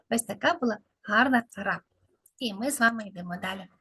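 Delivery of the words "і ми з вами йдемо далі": quick hushed whisper, no voice. Acted. Teacher leads the kids onward in a quick whisper. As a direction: whisper it fast like a secret.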